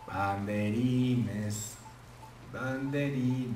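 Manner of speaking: slow, stretched word while looking through the menu; drawn out searching. Real, not acted. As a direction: drag the word out while hunting for it. (palabra alargada y lenta mientras busca)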